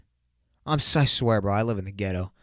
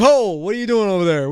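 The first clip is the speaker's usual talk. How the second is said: higher, louder, startled wake-up voice; mock startled; 8 semitones higher, 9 dB louder than usual. Waking up to his roommate, mock alarmed and higher. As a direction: jolt awake alarmed. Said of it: "I'll wake up and be like".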